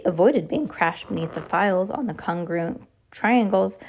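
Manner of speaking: usual speaking voice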